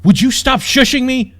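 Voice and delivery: outraged princess screams; furious scream. A narrator's reading. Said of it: "Jazz screamed".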